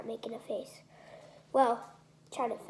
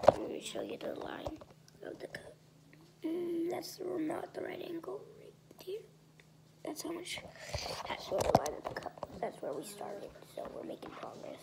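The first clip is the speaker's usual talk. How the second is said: whispering; 7 semitones higher, 4 dB quieter than usual. Real, not acted. Whispering about the cup.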